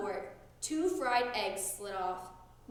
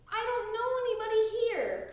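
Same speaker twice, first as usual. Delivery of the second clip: high, louder homesick cry; cried; 10 semitones higher, 4 dB louder, faster than usual. A narrator's reading. Froggy at camp, homesick and crying out. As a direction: plead like a scared new camper. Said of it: "cried Froggy".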